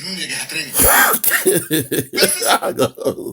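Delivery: quiet laughing fit; laughing at the guest; quiet amused laugh; real laughter. Real, not acted. This guy makes me laugh.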